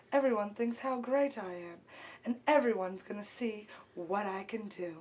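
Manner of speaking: low, soft, sultry purr; trying to be sexy. Pretend. Aspiring performer shows the host her low sultry sexy voice. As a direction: drop low and breathy-sultry to seduce. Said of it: "I could be sexy".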